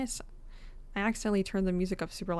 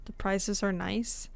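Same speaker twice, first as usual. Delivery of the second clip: ordinary delivery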